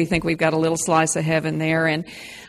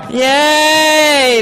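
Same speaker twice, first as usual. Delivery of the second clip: cheering; 10 semitones higher, 9 dB louder than usual. Real, not acted. Cheering after group shout.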